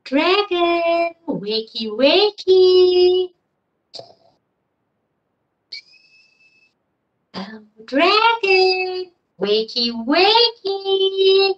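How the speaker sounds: sing-song wake-up call. Acted. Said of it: "she said".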